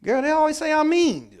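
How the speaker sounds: complaining friend. Acted. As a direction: gripe to a girlfriend. Quoting a complainer.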